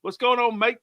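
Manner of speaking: amateur Australian accent. Shown as a demonstration. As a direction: try your best cheery Australian greeting. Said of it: "that's my best Australian accent".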